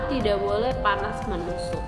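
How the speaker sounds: ordinary delivery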